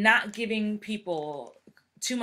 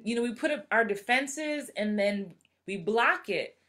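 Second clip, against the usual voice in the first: ordinary talk, nothing marked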